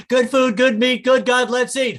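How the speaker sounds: high, loud rattled-off grace; a kid blurting a cheeky blessing, loud and high; quick blessing. Retold or imitated. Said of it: "he'd go".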